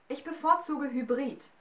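put-on a stubborn young witch correcting him; Galatea's retort. A narrator's reading. Galatea corrects the giant Loge, cool and cheeky.

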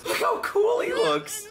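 high, slow, starry-eyed marvel; mock awe. Admiring the transformed character, slow hammed-up awe. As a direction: gush in exaggerated admiration.

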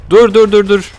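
high, loud rapid calls; frantic wait-wait; urgent repeated stop. Real, not acted. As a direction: hurry to halt things. Urgently stopping in game chaos.

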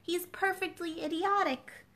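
scornful dismissal; Alice scoffing at the footman; desperately. A narrator's reading. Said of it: "said Alice desperately".

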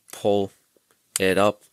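normal talking voice

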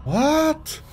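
very high drawn-out what; disbelieving cry; high long what of disbelief; stunned what. Real, not acted. Stunned at game event.

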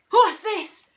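high, loud gasped question; shocked gasp. A narrator's reading. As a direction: blurt it out in astonishment. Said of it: "gasped Mister Twit".

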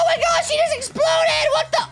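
very high, startled outburst; shocked shout. Pretend. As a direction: yell in disbelief at a sudden blast. Character explodes in game.

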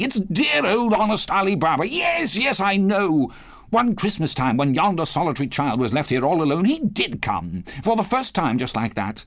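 high-pitched, rapturous recollection; ecstatic. A narrator's reading. Scrooge remembers his storybook visitor, ecstatic. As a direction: gush like a delighted child. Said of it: "Scrooge exclaimed in ecstasy".

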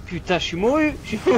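loud frustrated high cry; raging gamer. Real, not acted. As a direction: yell it at the screen. Dying, raging at game.

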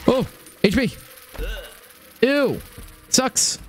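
high, panicked gamer voice; scared. Real, not acted. Streamer in a tough fight, high scared voice. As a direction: squeak it out, genuinely rattled. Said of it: "I'm so scared".

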